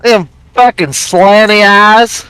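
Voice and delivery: slow, stretched Southern drawl. Shown as a demonstration. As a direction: drag it out in a Southern accent. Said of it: "you say it with a southern drawl".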